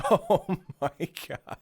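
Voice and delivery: high, loud laughing exclamation; real laughter at the story. Real, not acted. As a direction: burst out through laughter.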